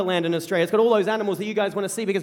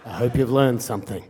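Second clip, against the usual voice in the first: low, slow impression of a stern father; stern dad; 8 semitones lower, slower than usual. Stern dad lecturing his kids, low and deliberate. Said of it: "stern, and, and this impatient man".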